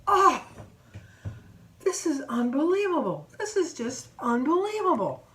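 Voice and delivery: loud high "Oh", then drawn-out disbelief; exasperated outburst. Retold or imitated. Customer behind huffs at the delay, exasperated. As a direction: mimic a customer huffing in disgust.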